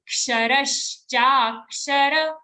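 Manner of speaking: slow, syllable by syllable, Ksharash cha; said slowly. Shown as a demonstration. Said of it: "say it slowly".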